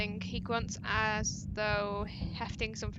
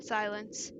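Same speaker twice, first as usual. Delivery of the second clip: ordinary delivery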